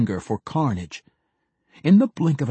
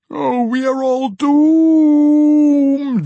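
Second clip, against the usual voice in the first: very high, loud, drawn-out howling lament; dramatic howling; 9 dB louder, much slower than usual. A narrator's reading. The howling mage laments to the dwarves. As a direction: howl out the doom dramatically. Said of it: "the howling mage went on dramatically".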